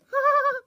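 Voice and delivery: short loud high laugh. Acted. Mocking the neighbour's bird remark with a high laugh. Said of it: "your laughs sound like a bird".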